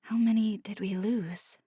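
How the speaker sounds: soft whispered question; small whispered fearful question. A narrator's reading. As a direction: ask it barely audible. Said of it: "I asked in a small voice".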